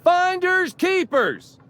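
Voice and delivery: high, drawn-out shout of triumph; loud calling out. Retold or imitated. Salvager re-enacting his claim on the shipwreck, calling out loudly. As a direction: holler the claim slowly from atop the wreck. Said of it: "called out in my loudest voice".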